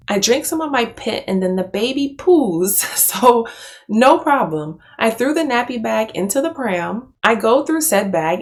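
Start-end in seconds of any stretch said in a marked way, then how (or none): none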